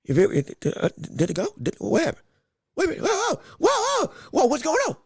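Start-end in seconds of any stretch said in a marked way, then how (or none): 2.8-5.0 startled whoa. Real, not acted. Startled by the stream glitch.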